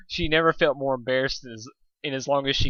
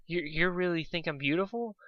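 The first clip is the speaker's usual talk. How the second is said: softer, higher, bashful; shy question; 5 semitones higher, 6 dB quieter than usual. A narrator's reading. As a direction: ask it shyly from behind her mane. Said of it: "hiding behind my mane".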